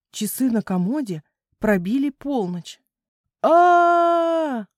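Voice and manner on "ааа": high, loud, sustained scream of "aaah"; held high yell of fright; long scream. A narrator's reading. Children terrified by the midnight chime, long scream. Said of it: "Хором заорали ребята".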